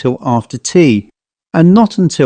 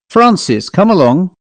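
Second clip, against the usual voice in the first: much higher call across the lawn; nurse calling; 6 semitones higher than usual. A narrator's reading. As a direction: call him in from afar.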